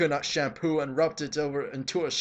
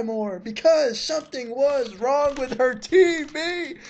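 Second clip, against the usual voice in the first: high, slow laughing, close to crying; can barely get the words out for laughing; real helpless laughter through the lines; laughing to tears; 13 semitones higher, 5 dB louder, slower than usual. Real, not acted. Cracking up while reading the fanfic.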